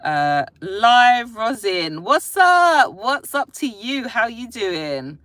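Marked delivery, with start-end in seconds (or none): 2.0-5.2 high, loud American-style greeting; sudden put-on accent. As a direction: slip into a brash American accent. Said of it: "Where did that accent come from?".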